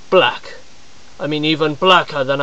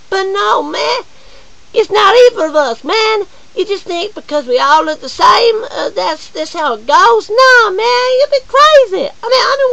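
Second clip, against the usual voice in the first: much higher and louder indignant shout; exasperated yelling; 4 dB louder than usual. Acted. Persona rebutting strangers, high exasperated yelling. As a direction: shout them down in disbelief.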